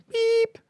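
very high, steady spoken beep; imitated censor beep. Shown as a demonstration. Storyteller hiding the supermarket name, high imitated beep.

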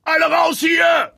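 high, slow, loud shout; thundering voice. A narrator's reading. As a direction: boom it over the crowd. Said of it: "mit donnernder Stimme".

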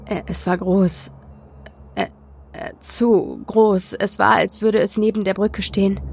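halting start with false starts and gaps; stammering. A narrator's reading. Frightened boy describing thing on the bridge, stammering. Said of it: "er begann zu stammeln".

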